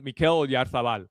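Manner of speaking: Mikel Oyarzabal said the Spanish way, with a lisped z as "th"; light Spanish accent on the name "Mikel Oyarzabal". Real, not acted. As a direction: say "Oyarzabal" like a Spanish announcer, oy-ar-THA-bal. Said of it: "I'm saying it with a Spanish accent".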